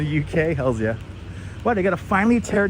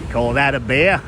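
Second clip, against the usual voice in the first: mock Aussie accent; 3 semitones lower, 5 dB louder than usual. Crocodile Dundee style quote.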